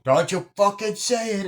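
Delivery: high, loud threat; mock angry threat. Pesci warning the guy off, angry threat. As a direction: warn him off hard.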